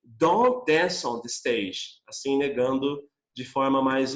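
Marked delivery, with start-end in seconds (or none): 0.0-2.0 each word spelled out slowly; slow teaching read. Shown as a demonstration. Teacher modelling the negative to students, very slow. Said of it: "eu também posso colocar dizendo".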